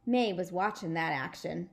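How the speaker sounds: ordinary delivery, nothing marked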